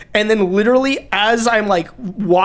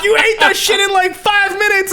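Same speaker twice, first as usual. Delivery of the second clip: amazed coworker; 12 semitones higher than usual. Retold or imitated.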